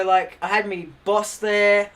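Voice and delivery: normal talking voice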